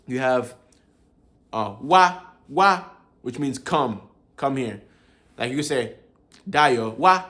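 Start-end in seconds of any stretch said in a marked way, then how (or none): 1.8-2.8 "wa" lifted on a high tone. Shown as a demonstration. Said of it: "that's the me intonation mark". 6.5-7.2 "wa" rising high and loud. Shown as a demonstration. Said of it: "that's the me intonation mark".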